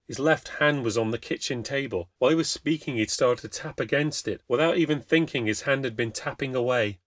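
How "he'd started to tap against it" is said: plain reading voice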